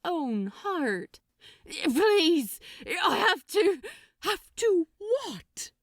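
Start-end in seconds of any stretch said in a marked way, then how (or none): 1.8-5.7 high, loud, desperate pleading; a demon fighting a compulsion, begging. Acted. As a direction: plead in anguish. Frantic desperate exchange.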